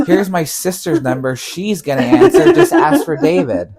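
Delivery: mock the friend's bad instructions while cracking up; laughing exasperated quote. Retelling to friends what David should've said, laughing.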